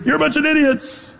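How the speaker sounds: half-hearted scream, pitched well up; a scream acted out without the volume; mock scream. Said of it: "or do you scream".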